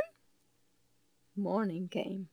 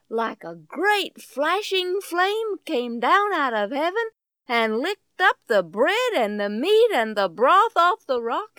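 plain reading voice

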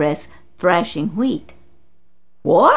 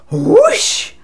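higher, louder whoosh of flame; storyteller's fiery whoosh; whoosh sound; 5 dB louder than usual. A narrator's reading. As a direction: make the rush of fire. Fire bursts from the rock.

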